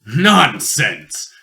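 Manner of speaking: loud scoffing nonsense; a hearty, loud rebuke; brusque dismissal. Acted. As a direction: wave the objection away forcefully. Rebukes the other father.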